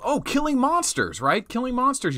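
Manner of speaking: high-pitched oh of remembering; sudden realization. Real, not acted. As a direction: recall it with a bright jump up.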